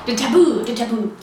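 ordinary talk, nothing marked

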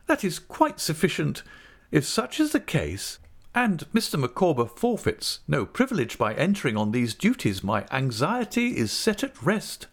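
high-pitched, emphatic wifely conclusion; Mrs Micawber, satisfied. A narrator's reading.

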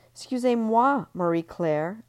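high, loud mock-polite French; cutting in. Ellie cutting off Marie Claire, loud and mocking. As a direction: slice in over her.

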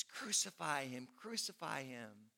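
normal talking voice

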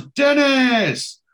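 high, loud call of a name across the pub. Retold or imitated. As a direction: shout the name out to greet an old friend. Greeting a mate walking in.

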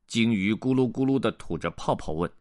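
plain reading voice

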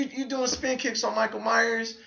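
ordinary talk, nothing marked

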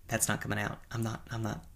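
usual speaking voice

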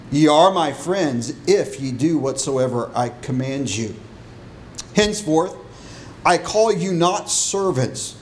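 solemn King James reading voice; scripture reading. Acted. Reads scripture aloud before sermon.

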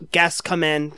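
normal talking voice

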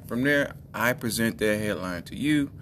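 ordinary talk, nothing marked

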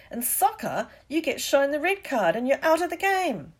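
high, loud indignant explanation; worked-up fan arguing the rules; furious. A narrator's reading. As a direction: protest hotly with rising agitation. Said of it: "said Dean furiously".

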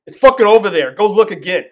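mock rude snap. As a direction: turn rude on the customer in an instant. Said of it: "asshole mode".

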